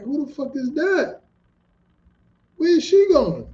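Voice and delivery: rising, baffled outrage; shocked. Retold or imitated. Drunk narrator sees a stranger jump in, shocked. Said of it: "and I'm like".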